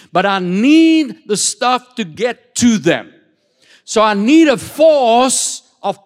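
preacher voicing God's decree to his angels, slow and emphatic. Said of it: "he says".

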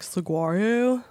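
Saguaro? drawn out with a slow rise; slow valley girl question. Retold or imitated. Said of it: "I say it like a valley girl".

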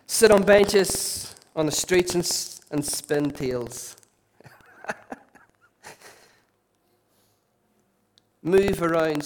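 slow, measured Bible reading; scripture reading. Acted. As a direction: savour each phrase of the verse. Reads scripture slowly aloud.

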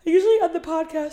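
words through a real yawn. Real, not acted. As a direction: yawn while you say it. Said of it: "sorry, I'm yawning".

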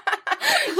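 sudden high squeal of a laugh; high burst of laughter. Real, not acted. Friend misheard, both crack up.

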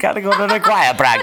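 laughing mock accent; laughing mimicry. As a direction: giggle through the accented line.

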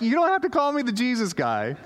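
playful embarrassed pushback; laughing protest. Retold or imitated.

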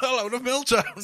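delighted winner. Pretend. Lottery winner voice again.

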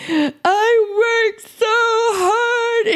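high, slow sobbing wail; husband breaking down in tears over his old injury, sobbing high and slow; crying, voice breaking. Retold or imitated. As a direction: imitate him breaking down in tears. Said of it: "and just starts crying".

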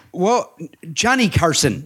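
high, slow spelling-out of the obvious; incredulous correction. Retold or imitated. Comic corrects executive who mistook which Carson, incredulous. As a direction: spell it out slowly, pitched high, in disbelief.

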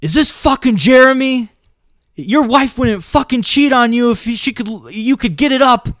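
high-pitched yell into the phone, retold. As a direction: act out yelling at a prank caller. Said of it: "And I yell that at this person".

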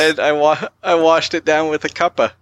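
badly faked British accent; mock British accent. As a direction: add to the sentence as a pretend Brit. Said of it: "you're trying to do a British accent".

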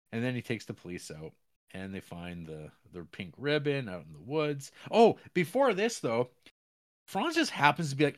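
ordinary talk, nothing marked

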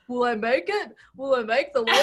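high, loud mock-heroic boyfriend; hammed-up boyfriend voice. Boyfriend diving for laundry, hammed-up laughing voice.

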